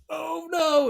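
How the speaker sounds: high, drawn-out wail of dismay; mock dismay. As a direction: stretch out a high "oh no" in play-horror. Mock dismay while retelling the scene.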